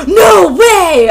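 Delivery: big gasp, then loud high disbelief; hammed-up amazement at an obvious reveal; mock shock. Reader mocking the Voldemort reveal, in fake shock.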